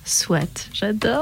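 breathy whispered tease, almost unvoiced; whispered aside. Real, not acted. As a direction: lean in and whisper it playfully. Teasing aside after guest's answer.

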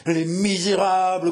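high, slow, drawn-out fury; raving growl. A narrator's reading. Mad king against the plotters, growling rage. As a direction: stretch it out in crazed rage. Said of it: "gronda-t-il".

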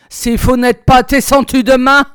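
loud, high threatening shout; raging. A narrator's reading. Officer's last threat before leaving, angry shout. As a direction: hurl the final warning. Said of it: "Il rageait".

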